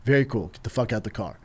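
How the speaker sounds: cool, hushed order; very calm. Retold or imitated. Husband orders the driver out of the car, very calm. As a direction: drop low and soft, fully in control. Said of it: "I just very calmly".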